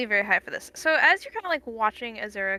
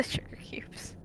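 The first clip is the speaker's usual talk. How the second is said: whispering; 11 dB quieter, slower than usual. Real, not acted. GM whispers through laugh.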